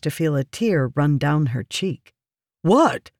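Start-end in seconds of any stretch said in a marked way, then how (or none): 2.6-3.1 raised, mildly alarmed question. A narrator's reading. Man reacting to her tears, alarmed. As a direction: perk up in sudden alarm. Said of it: "Merrin asked with alarm".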